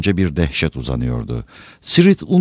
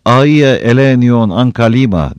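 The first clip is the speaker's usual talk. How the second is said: loud, slow, high invocation; drawn-out ringing shout; Frodo's shouted cry; 6 semitones higher, 9 dB louder, slower than usual. A narrator's reading. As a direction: call it out long and strong. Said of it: "diye bağırdı Frodo".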